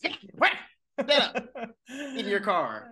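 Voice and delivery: drawn-out, high mock command with a laugh; slow laughing mock order. Host playing Carrie ordering Big away, laughing and slow. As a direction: stretch it out, laughing.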